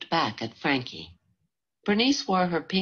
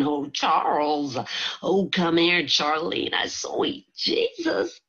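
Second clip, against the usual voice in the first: mock police hollering; 2 semitones higher, slower than usual.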